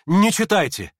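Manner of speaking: loud, high, urgent plea; crying out. A narrator's reading. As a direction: cry out to stop them. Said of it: "крикнул и князь".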